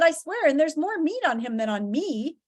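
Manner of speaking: mildly frantic plea; begging insistence. Acted. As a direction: swear it to save your skin. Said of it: "started begging".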